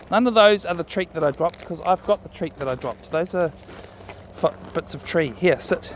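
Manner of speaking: ordinary talk, nothing marked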